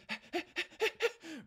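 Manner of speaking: high, gleeful little giggle; a happy squeal of a laugh; excited giggle. Acted. Noob finding his lost bobblehead, high delighted giggle.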